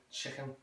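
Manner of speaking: "chicken" as Scouse "chichen"; Liverpool pronunciation. Shown as a demonstration. Teacher repeating the word, "chicken" with throaty hissed K. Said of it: "back of the throat with the ichen".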